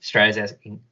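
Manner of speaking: ordinary talk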